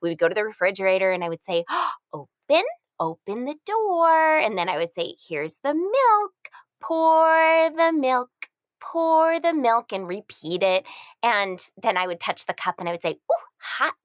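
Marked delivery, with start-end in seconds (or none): 1.7-4.4 put-on high, slow, drawn-out baby talk; gasp, then teach the word to a baby; parentese. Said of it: "using that parent ease voice". 5.3-9.9 high, very slow sing-song; parentese. Retold or imitated. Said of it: "using that parent ease voice". 13.3-13.9 put-on high warning "ooh, hot" for a baby; parentese. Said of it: "using that parent ease voice".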